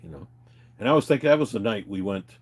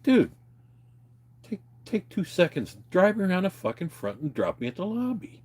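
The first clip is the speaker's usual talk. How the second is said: pleading complaint; 9 semitones higher than usual. Retold or imitated. To the driver dropping him out back, higher and pleading. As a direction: beg him to drive round the front. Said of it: "I'm like".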